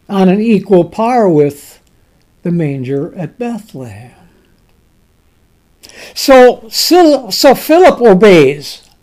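usual speaking voice

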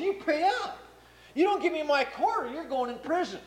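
high, harsh mock threat; angry demand. Servant grabbing his friend by the throat, high angry demand. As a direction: squeeze him for the quarter.

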